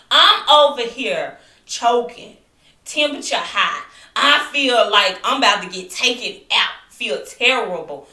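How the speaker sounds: loud, emphatic griping; exasperated complaint. Retold or imitated. Sick patient griping about what doctors say, loud exasperation. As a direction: belt it out, dragging the misery.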